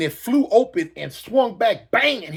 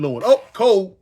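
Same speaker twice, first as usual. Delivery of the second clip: ordinary talk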